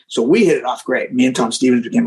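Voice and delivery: ordinary delivery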